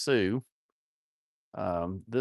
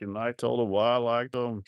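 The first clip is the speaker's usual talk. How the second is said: ordinary talk, nothing marked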